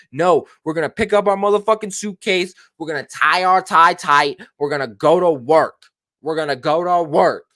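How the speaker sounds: rally the team like a coach; fired-up coach. Retold or imitated. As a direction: coach giving his players a fired-up pep talk. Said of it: "I'm a coach of the Zappers".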